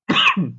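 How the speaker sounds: short, loud cough; throat-clearing cough. Real, not acted. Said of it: "थोड़ा सा गला खराब". (गला खराब होने से ज़ोर की खाँसी)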